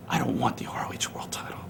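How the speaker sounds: disdainful whisper. Acted. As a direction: whisper it with contempt. Dismissing the title.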